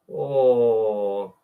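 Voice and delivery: ordinary talk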